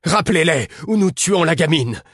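high, loud frantic shout; a terrified man crying out at the bees; panicked cry. A narrator's reading. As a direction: yell it out in sudden panic. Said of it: "s'écria-t-il soudain".

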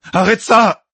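high, loud demand to stop; desperate shout. A narrator's reading. Captive shouts at the torturer to stop, desperate. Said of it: "cria-t-il à l'intention de".

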